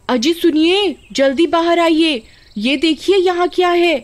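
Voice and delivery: high, excited wife calling her husband; urgent, high-pitched summons; calling out urgently. Acted. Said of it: "क्यों चिल्ला रही हो".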